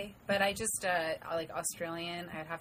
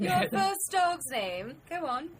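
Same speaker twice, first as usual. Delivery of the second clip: put-on slow, higher British accent like Mary Poppins; mock British accent; 10 semitones higher, much slower than usual. Playing a scammer fishing for personal details, in mock British accent. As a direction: coax it out in a prim British accent. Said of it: "You watch Mary Poppins a lot?".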